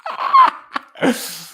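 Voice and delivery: a sharp, high, airless laugh; real laugh, way up high; breathy high laugh. Real, not acted. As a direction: laugh it out in disbelief. Laughs at Spanish-only stream.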